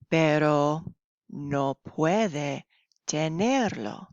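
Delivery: slow, clear reading to learners; slow story reading. Acted. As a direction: read each phrase slowly for beginners. Reads story slowly to class.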